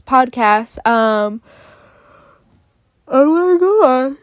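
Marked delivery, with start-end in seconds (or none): 3.1-4.2 slow yawn through the words; high stretched yawning oh my God. Real, not acted. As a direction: talk through a real yawn. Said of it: "there it is again. Yawning".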